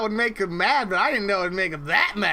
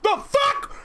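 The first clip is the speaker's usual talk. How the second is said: high mock outburst. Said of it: "he's gonna be like".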